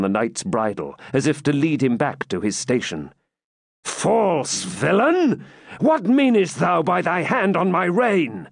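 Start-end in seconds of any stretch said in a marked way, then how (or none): 3.8-8.5 a mild angry outburst, higher, slow on the insult. Acted. Sir Brian to Malvoisin grabbing his bridle, mildly angry. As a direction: snap at the man holding his rein, understated. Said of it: "said Sir Brian angrily".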